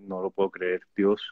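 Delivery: low, hushed retold reaction; understated stunned disbelief. Actor retelling how he heard he got the part, softly stunned. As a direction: say it softly, as if barely believing it. Acts his reaction to getting role.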